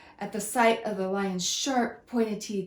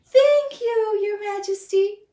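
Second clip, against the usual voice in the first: high, loud squeaky thanks; squealing mouse; 12 semitones higher, 9 dB louder than usual. Acted. Mouse thanks the lion, high and squealing. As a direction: squeal with grateful relief. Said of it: "he squealed".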